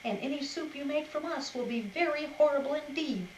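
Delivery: hammed-up grumbling to fool the wolf; grumbliest voice. A narrator's reading. Cuddle Mop pretending to be grumpy to the wolf, hammed-up grumble. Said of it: "in the grumbliest voice she could muster".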